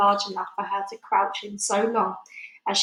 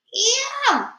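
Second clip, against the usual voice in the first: long, high-pitched squeal; loud drawn-out shriek, falling at the end; high cry; 6 dB louder than usual. Acted. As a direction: squeal as if caught in a giant's mouth. Girl inside the giant's mouth.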